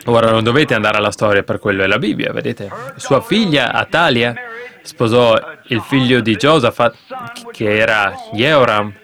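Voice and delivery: ordinary talk, nothing marked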